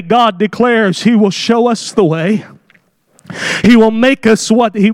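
usual speaking voice

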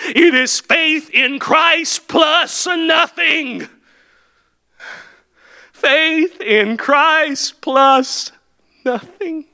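very slow, high shouted proclamation; preaching at full force. Acted. Preacher to his congregation, drawn-out shouted climax. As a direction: lean on every word, then drop away on nothing.

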